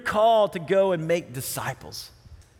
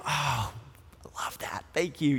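softer, lower, almost breathless thanks; hushed awe; 6 semitones lower, 6 dB quieter than usual. Retold or imitated. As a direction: barely voice it to yourself. Watching your kids, moved.

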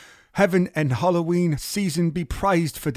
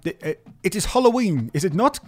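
calling out; 6 semitones higher, 4 dB louder than usual. Acted. Calling to boy below.

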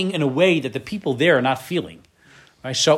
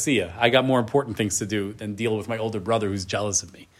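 dismissive brush-off; 5 semitones lower, 6 dB quieter than usual. Retold or imitated. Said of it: "turns away from his brother and says".

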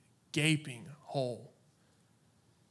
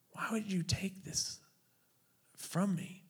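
whispered plea; 6 semitones higher than usual. Retold or imitated. Shaking his fist at God.

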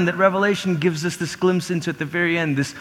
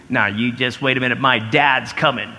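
lower-pitched mock bratty kid; lightly voiced taunting child; 6 semitones lower than usual. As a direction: play a kid warning off a bully. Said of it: "in a not so bratty voice".

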